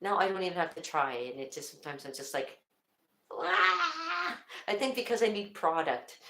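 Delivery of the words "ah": high drawn-out "aah"; comic wail. Acted. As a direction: let out a silly high-pitched cry. Acts out wild hair with noise.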